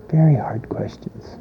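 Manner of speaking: breathy hushed aside to the congregation; whisper. Real, not acted. Preacher whispers to his listeners how hard these questions are. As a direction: drop to a whisper on the hard part.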